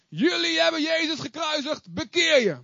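bold Peter preaching. Acted. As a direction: proclaim it to the whole crowd. (Petrus spreekt de menigte hoog en krachtig toe)

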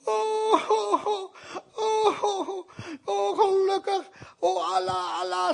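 crying servant. Retold or imitated. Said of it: "En zij begon te huilen".

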